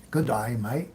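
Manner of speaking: put-on amateur Aussie accent, DAY said like "die"; not-very-good Australian twang on "mate"; attempted Australian accent. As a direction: have a half-hearted go at an Australian accent. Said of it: "Trying to say it with an accent".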